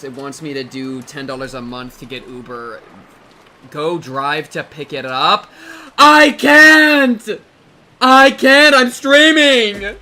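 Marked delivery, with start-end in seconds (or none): 6.0-10.0 high, loud panicked yell. Real, not acted. Streamer to chat, yelling that he can't leave.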